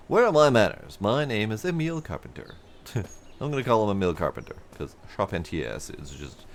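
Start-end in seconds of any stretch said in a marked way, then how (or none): 0.1-2.6 lightly voiced cheerful foreman, louder and bright; a hint of a welcoming foreman; friendly supervisor. Player voicing a supervisor greeting a traveler, hearty. NPC dialogue.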